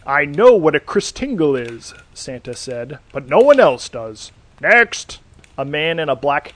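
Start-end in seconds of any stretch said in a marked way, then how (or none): 0.1-1.9 louder impatient snap; irritated retort. Acted. Santa irritated at the oddball. 3.1-5.2 loud, rising dismissal; exasperated shout. Acted. Santa dismisses the candidate.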